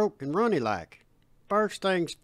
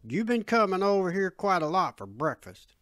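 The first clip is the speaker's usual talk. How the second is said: pointed, irritated dad; edge in voice; 3 dB louder than usual. Acted. Dad confronts Bud over free breakfasts, with an edge. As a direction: put a mild edge on it. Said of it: "an edge in his voice".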